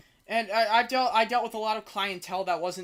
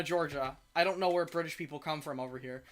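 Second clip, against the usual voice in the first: ordinary talk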